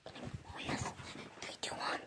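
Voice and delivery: whispered countdown; hushed countdown before the scare; whispering. Real, not acted.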